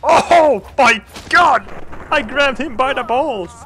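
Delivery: high, loud, stretched-out disbelief; excited shout. Real, not acted. Player cheering his own brutal kill, excited shout. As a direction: shriek it out in glee.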